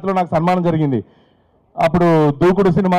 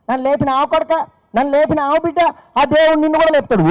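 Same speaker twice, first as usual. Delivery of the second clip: put-on old woman's blessing; 8 semitones higher than usual.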